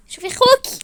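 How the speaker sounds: high-pitched, insistent complaint; exasperated appeal. Acted. Wife tells sister to see her brother, shrilly exasperated.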